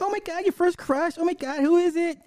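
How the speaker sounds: high squealing delight; excited gush. Retold or imitated. Hillary thrilled about a girl's first crush, high squeal.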